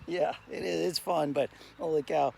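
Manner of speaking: bug in throat. Real, not acted. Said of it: "it's in the back of my throat".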